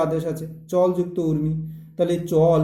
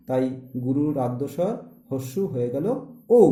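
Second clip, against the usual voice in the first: ordinary delivery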